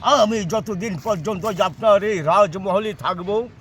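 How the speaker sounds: melodramatic folk theatre. Acted. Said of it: "একটা যাত্রার dialogue দিচ্ছি".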